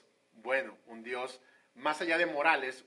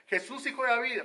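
Jesús, hijo de David shouted high and loud; acted shout; 8 semitones higher, 3 dB louder than usual. Blind Bartimeo calls out to Jesus, shouted. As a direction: cry out hijo de David as a blind beggar calling. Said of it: "cuando él grita".

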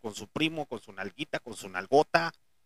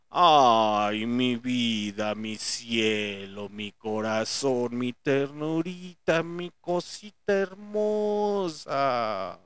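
put-on cooing baby talk; 3 semitones lower, much slower than usual. Mocking baby talk again.

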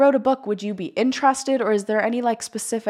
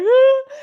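high, loud mock wail; retold crying; 11 dB louder than usual. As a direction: imitate bursting into tears. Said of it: "I started crying".

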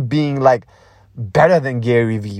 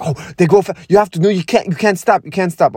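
higher, urgent inner voice tripping over itself; pitched-up, frantic self-talk; anxious brain's voice; 5 semitones higher, faster than usual. Acted. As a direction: play the panicking brain, cutting itself off. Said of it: "the brain was like".

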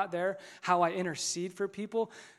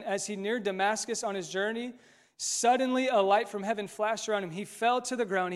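ordinary talk, nothing marked

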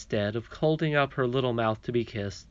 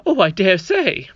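doubtful nurse; 7 semitones higher, 9 dB louder than usual. Acted.

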